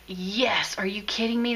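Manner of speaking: breathless whispered delight; excited whisper. Retold or imitated. Aunt whispering delight to her nephew on Christmas morning, excited whisper. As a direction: whisper it like a thrilled secret.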